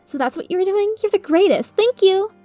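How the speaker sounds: high delighted gushing; gushing thanks. Acted. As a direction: thank them with joy. Lyra gushes gratefully.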